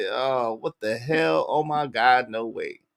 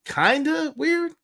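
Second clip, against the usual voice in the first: incredulous; 12 semitones higher, slower than usual. Real, not acted. Echoes understated remark incredulously.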